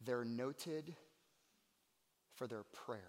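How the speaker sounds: soft, low and reverent; quiet, weighty sermon aside; hushed preaching. Real, not acted. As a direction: drop the line down to a hush. Preacher repeats line, hushed then forceful.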